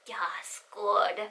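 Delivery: hushed, breathy villain whisper; quiet gloating. Retold or imitated. Holding plushie hostage, gloating.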